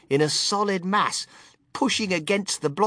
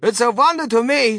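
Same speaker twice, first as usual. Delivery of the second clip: high, loud character voice; raised, baffled tone; puzzled father; 8 semitones higher, 7 dB louder than usual. A narrator's reading. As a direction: wonder aloud as a flustered father. Mister Gloop's voice in story.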